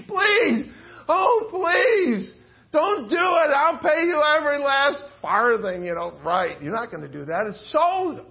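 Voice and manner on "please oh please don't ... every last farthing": higher, pleading cry of a desperate servant; high pleading wail; begging servant. Acted. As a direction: beg for mercy, voice pitched up and crying. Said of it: "He begs".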